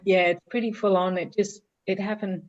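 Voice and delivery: holding back tears while speaking; after crying. Real, not acted. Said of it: "sorry if I get teary".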